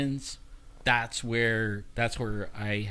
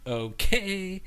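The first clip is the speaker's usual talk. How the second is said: hesitant, drawn-out agreement, pitched up; reluctant; 7 semitones higher than usual. Retold or imitated. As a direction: agree warily after a little laugh. Retold reluctant agreement to doctor.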